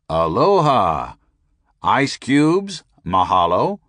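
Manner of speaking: slow drawn-out call through a bullhorn. A narrator's reading. Narrator calls for ice over the bullhorn, drawn out. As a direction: call out slowly like an announcer. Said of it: "saying very gently and calmly".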